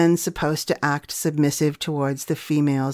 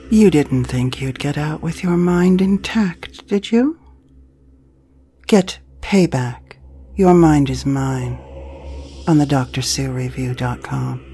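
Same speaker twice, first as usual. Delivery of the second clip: teasing villain voice; 3 semitones lower, 3 dB louder than usual. Acted. Promo tag in villain voice.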